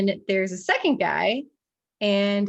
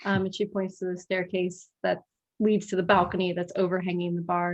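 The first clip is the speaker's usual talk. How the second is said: usual speaking voice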